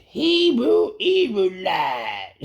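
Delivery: high, slow drawn-out call; yelled out. Retold or imitated. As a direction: stretch it long and high like calling across the yard. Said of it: "So he says".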